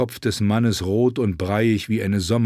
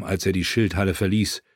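ordinary delivery

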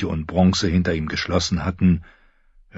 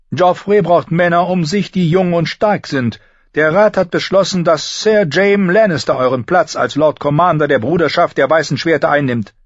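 Cersei announcing; 10 semitones higher, 5 dB louder than usual. A narrator's reading. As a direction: pronounce it like a queen dismissing him. Cersei continues her decree.

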